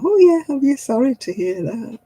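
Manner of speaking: high sugary smile in the voice; sweet fake sympathy. To people moaning about sore backs, sweetly faked sympathy. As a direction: smile sweetly through gritted politeness. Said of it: "smile sweetly and go".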